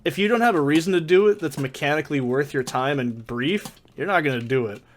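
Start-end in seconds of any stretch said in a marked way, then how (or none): none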